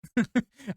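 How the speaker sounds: sudden bright laugh; laughing at own slip. Real, not acted. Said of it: "I do apologise".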